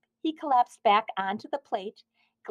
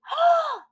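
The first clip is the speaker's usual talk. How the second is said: shocked gasp; 6 dB louder than usual. Acted. Shocked donut.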